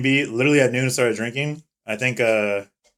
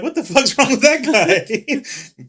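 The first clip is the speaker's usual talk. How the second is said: drawn-out, high, puzzled question; slow and baffled at a stranger; spotting a drunk friend, slow incredulous question; startled disbelief; 11 semitones higher, 4 dB louder, much slower than usual. Retold or imitated. Seeing drunk friend stumbling.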